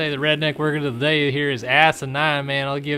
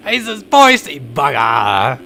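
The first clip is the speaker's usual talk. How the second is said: slow, hammed-up Australian drawl on "crikey"; mock Australian accent; 3 semitones higher, 5 dB louder, slower than usual. Co-host mocking partner's accent attempt, slow hammy Australian. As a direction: ham up an Aussie bloke, slowly. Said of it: "the prime Australian accent word".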